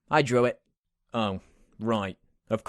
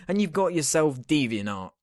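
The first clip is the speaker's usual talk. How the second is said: usual speaking voice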